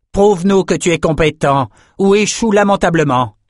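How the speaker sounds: high-pitched commanding cry; shouted challenge. A narrator's reading. Adessa challenges the reluctant fighter, shouted challenge. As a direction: raise your voice to goad him into proving himself. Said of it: "cria Adessa".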